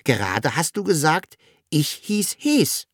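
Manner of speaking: high, wondering little creature; puzzled. A narrator's reading. Sams wondering at the dragon's answer, high puzzled voice. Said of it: "Das Sams wunderte sich".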